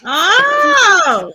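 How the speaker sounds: loud, high startled "Oh!"; surprised shout. Real, not acted. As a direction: blurt it out in shock. Reactor reacts to clip.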